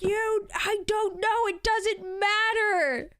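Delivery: high, slow, whiny mock wail; exasperated friend. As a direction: drag it out like a fake sob of exasperation. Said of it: "she gets so mad every time".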